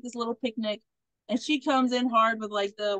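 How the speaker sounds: normal talking voice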